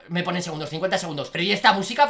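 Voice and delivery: usual speaking voice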